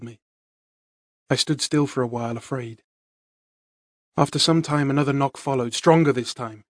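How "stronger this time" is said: ordinary delivery, nothing marked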